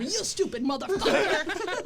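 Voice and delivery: retold yelling. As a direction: re-enact yelling after him, half laughing. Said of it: "I'm still yelling at him".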